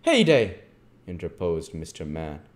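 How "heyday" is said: loud, high burst of indignation; outraged interjection. Acted. Missus Mann cutting off the child, outraged. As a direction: cut in, scandalised.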